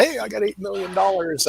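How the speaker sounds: normal talking voice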